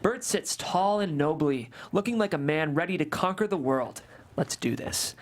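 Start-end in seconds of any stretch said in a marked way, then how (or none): 4.4-5.1 whispered resolve. Acted. Hero about to click buy.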